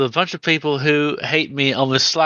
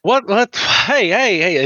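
startled calling out; 7 semitones higher, 3 dB louder, slower than usual. Acted.

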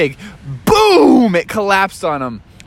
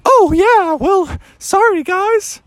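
high-pitched, sheepish little voice; high sheepish Daniel; slower than usual. Acted. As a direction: squeak out a sheepish sorry. Daniel Tiger apologizes sheepishly.

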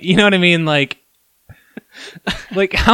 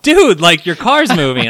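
louder urgent call, pitch up; yelling warning; 5 semitones higher, 8 dB louder than usual. Retold or imitated. Neighbor yelling a warning to the driver, urgent shout. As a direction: warn him loudly from afar. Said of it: "I yell".

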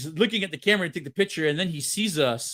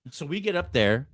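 ordinary delivery

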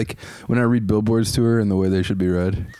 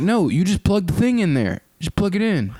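higher, patient-but-fed-up explaining; exasperated; 8 semitones higher than usual. Retold or imitated. Exasperated reply to complainers.

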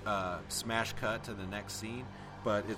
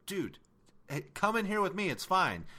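mock friendly invite; 8 semitones higher than usual. Survivor beckoning a friend into the hole, mock cheerful call. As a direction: wave him in with mock cheer. Voicing a character calling a friend over.

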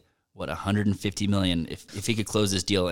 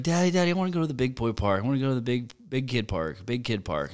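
put-on high, fast, begging little kid; imitating his little girl pleading to go to the park; little daughter's voice; 4 semitones higher than usual. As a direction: whine it like a small child tugging at dad. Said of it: "She's like".

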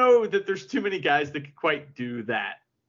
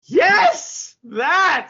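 loud excited shout; shouting yes that; 12 semitones higher, 9 dB louder than usual. Retold or imitated. Referee shouting at the fan, a loud excited yell. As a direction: yell it out pointing. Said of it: "And he's like".

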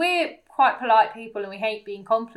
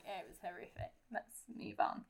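hushed, breathy aside, much softer; mock whisper; 20 dB quieter than usual. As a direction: whisper it like a guilty confession. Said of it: "And you went like".

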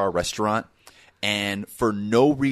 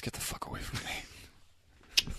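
whisper; 13 dB quieter, slower than usual. Acted. Recoiling joke.

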